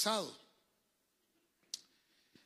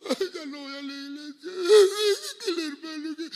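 high whiny mock sob; mock whiny churchgoer; 10 semitones higher, 11 dB louder than usual. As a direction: whine like a crybaby leaving church. Imita al quejoso llorón. (lloriqueo burlón)

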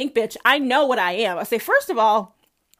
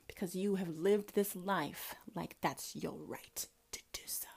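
menacing whisper; 4 semitones lower, 14 dB quieter than usual. Real, not acted.

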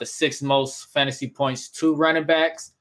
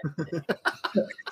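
high laugh; 4 semitones higher, 7 dB quieter than usual. Real, not acted. Laughing at own bad accents.

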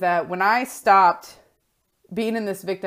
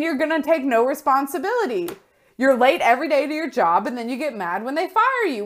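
raised, high-pitched exasperated telling-off; blunt coach scolding; 8 semitones higher, 5 dB louder than usual. Acted. Coach confronting a client who blames others, exasperated scolding. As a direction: tear into their excuses, incredulous. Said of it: "somebody that's gonna be like".

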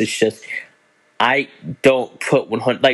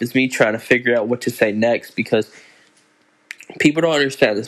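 normal talking voice

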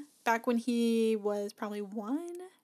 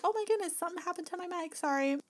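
mock embarrassed apology; 6 semitones higher, 5 dB quieter, faster than usual. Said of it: "I was like".

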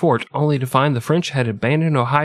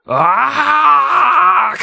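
sudden high shouted ach, mock Hitler; loud Hitler ach; 6 dB louder than usual. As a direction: bark one loud harsh ach like a hammed-up dictator. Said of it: "That was my Hitler impersonation. Just a loud "ach" noise".

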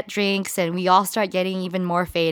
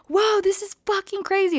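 amazed; 12 semitones higher than usual. Retold or imitated. Retelling her amazed reaction.

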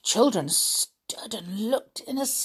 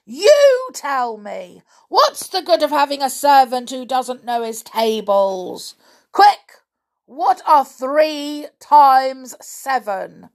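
loud, raised, impatient giant; angry demand; 6 semitones higher, 9 dB louder than usual. A narrator's reading. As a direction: bark it at the hapless servant. Roaring giant presses his servant.

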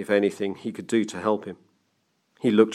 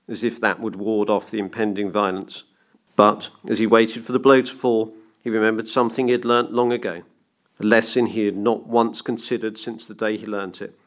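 ordinary delivery

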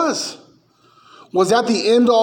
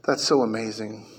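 mock gushing pastors; 8 semitones lower, 9 dB quieter than usual. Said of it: "pastors look at the Wailing Wall and go".

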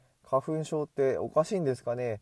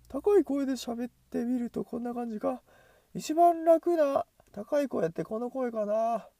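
much higher, strained voice; 11 semitones higher than usual. Shown as a demonstration. Vlogger experimenting with talking in a much higher voice. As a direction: talk way up in a high register.

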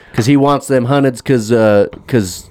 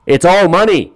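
lightly voiced Steve, a bit higher and louder; mocking Steve Harvey; 8 semitones higher, 4 dB louder than usual. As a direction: parrot Steve's line with mild mockery. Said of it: "Steve, uh".

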